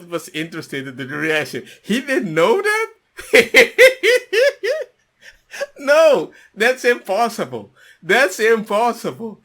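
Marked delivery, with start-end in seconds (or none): 1.8-3.8 loud high burst of laughter; disbelieving laughter. Real, not acted. Host hearing the guest paid at a brothel, bursting into loud laughter. 5.8-9.4 loud, half-crying laughing protest; laughing disbelief. Real, not acted. Host refusing to believe the story, laughing loudly.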